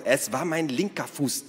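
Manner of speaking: high, mildly cross correction; hissed retort. A narrator's reading. Naru corrects Ada about his foot, hissing. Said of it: "zischte Naru".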